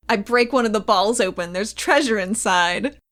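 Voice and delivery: normal talking voice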